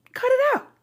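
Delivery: high mocking Cut it out; high squeak. Shown as a demonstration.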